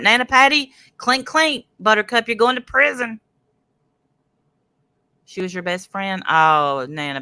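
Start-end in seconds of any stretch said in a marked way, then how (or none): 0.0-3.2 singsong mocking jab, pitched higher; gleeful taunt. Real, not acted. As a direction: rub it in like a playground tease. Taunting a rival about prison.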